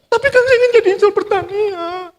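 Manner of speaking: put-on high, pleading boy's voice; pleading son. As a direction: beg like a desperate child. Son begs father again.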